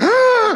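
sharp high gasp of horror; loud startled intake of breath; shocked gasp. Retold or imitated. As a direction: gasp like you've just been caught. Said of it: "Deborah's just like".

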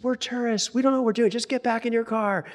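high-pitched, exasperated mock yell; retold yelling. Band leader to angry driver in retold roadside row, high mock yelling. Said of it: "he starts yelling at the guy".

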